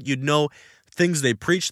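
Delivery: usual speaking voice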